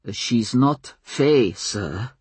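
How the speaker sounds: slow, humble and respectful; deferential. A narrator's reading. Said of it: "in a deferential tone".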